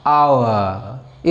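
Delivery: आवर drawn out very slowly, aa-oo-uh; slow correct pronunciation. Shown as a demonstration. Teacher giving students the correct hour, very slow. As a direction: stretch आवर into three slow parts. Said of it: "सही sound इसका है".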